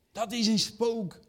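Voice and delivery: high, slow mock cry of fear; "Dat is een spook" drawn out high in mock alarm; mock fright. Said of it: "de eerste menselijke reactie".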